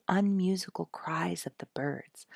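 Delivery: usual speaking voice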